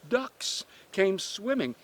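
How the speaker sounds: usual speaking voice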